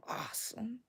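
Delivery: hushed, breath-only emphasis; awed whisper. Real, not acted. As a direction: drop to a whisper in admiration. Recalling dad's impressions with admiration.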